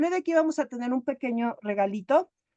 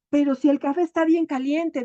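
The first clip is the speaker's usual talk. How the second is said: fussy husband grumble, higher and louder; mock complaint; 4 semitones higher, 5 dB louder than usual. Husband complaining to his wife about hot coffee, fussy. Marido quejándose del café.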